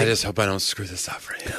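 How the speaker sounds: whispered, under the breath; nervous whisper to oneself; hushed aside. Acted. Speaker privately fretting over the talk, hushed aside.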